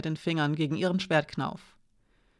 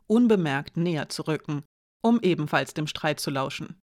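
plain reading voice

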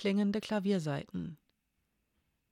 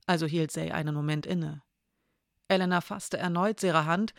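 usual speaking voice

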